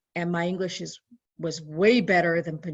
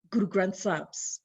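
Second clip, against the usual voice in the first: ordinary delivery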